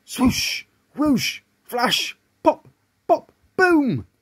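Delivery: punchy storybook noises, rising high; magic sound effects. A narrator's reading. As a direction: act out each burst of the spell.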